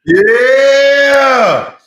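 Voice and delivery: loud, high drawn-out yell; shouting. Real, not acted. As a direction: yell to be heard over a bad connection. Calling out over bad audio.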